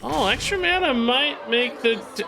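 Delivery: stunned by the play; surprised. Real, not acted. Shocked at opponent's play.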